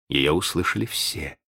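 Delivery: ordinary delivery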